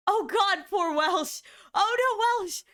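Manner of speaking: high, loud cry of sympathy; loud dismay. Real, not acted. Streamer watching poor Welsh lose, loud dismay. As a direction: wail in alarm for a friend.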